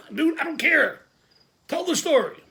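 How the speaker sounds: exasperated high-pitched shout; impatient outburst. Retold or imitated. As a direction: cut the rambler off with a yell. Impatient with Kick's rambling.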